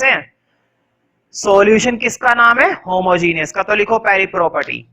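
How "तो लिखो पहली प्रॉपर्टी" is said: ordinary talk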